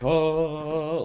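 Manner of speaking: sudden high, loud oh; jolted, dizzy cry of oh; startled oh. Retold or imitated. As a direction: yelp it out like the ground just tilted. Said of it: "like that".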